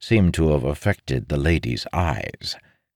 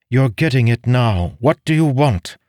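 high, loud insistent woman's question; impatient demand; 8 semitones higher, 6 dB louder than usual. Acted. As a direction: press him for an answer. Impatient noblewoman presses her question.

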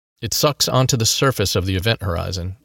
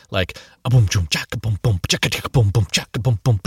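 beatboxing. A narrator's reading. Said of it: "electronic dance hip-hop fight music".

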